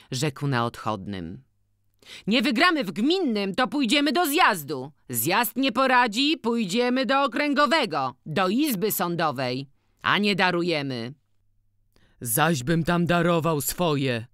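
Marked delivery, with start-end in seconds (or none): 2.3-11.1 high, loud, eager clerk's voice; scribe promising appeals. Acted. Scribe boasting to Boryna he will win the case, raised and high. 12.2-14.3 dogged. Acted. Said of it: "zawołał z zawziętością".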